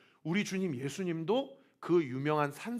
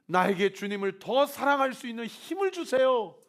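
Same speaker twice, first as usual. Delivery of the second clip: building from calm vow to a loud, higher plea; fervent prayer; 8 semitones higher, 5 dB louder than usual. Acted. Pastor leads congregation in new-year prayer, building to fervent plea. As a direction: pray harder and harder for strength.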